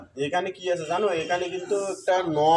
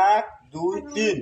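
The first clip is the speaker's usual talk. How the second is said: counting out syllables; 3 semitones higher than usual. Shown as a demonstration.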